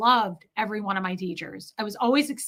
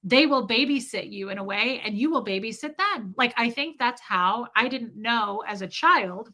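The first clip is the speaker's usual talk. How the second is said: normal talking voice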